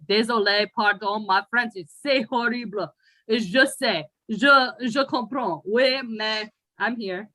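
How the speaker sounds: a hint of a French accent in broken French, apologetic; mock French apology. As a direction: stumble through the apology in playful broken French. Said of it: "To all the French people listening".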